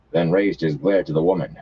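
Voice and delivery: ordinary delivery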